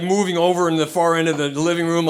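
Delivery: normal talking voice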